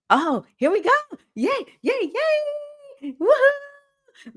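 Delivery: high, bright whoops of celebration; excited cheering. Real, not acted. Host cheering a viewer's celebration, excited whoops. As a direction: cheer them on with big woohoos.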